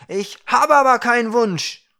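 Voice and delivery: high, loud protest; defiant shout. A narrator's reading. Dwarf kicking in the air at the Ukra, shouting high. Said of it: "Er strampelte in der Luft und rief".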